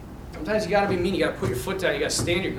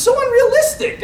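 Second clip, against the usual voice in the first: exasperated squeak; 4 dB louder than usual. Real, not acted. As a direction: voice jumps much higher in disbelief. Incredulous about princess photo.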